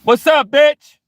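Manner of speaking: high, loud mock shout; playing irate. Man shouts at the gossips to play irate. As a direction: bark it to scare the starers.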